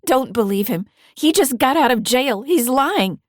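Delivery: put-on high, loud, indignant teen protest; a frightened girl insisting she was taken; girl pleading with state troopers, high and loud; upset teenage girl. A narrator's reading. Girl contradicts her grandfather.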